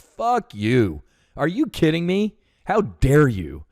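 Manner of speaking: indignant retort, pitch leaping up on the question; outraged. Retold or imitated. Veteran answers a congressman's brush-off, outraged. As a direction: retell it affronted, slowing hard on the last challenge.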